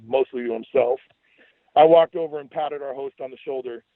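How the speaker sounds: plain reading voice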